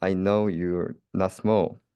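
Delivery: plain reading voice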